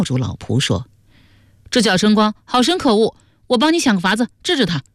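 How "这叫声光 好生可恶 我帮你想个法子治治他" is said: high, brisk character voice; Yu Baobao, indignant. Acted. Offering to punish a rogue for a companion, indignantly. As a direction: offer help with lively indignation.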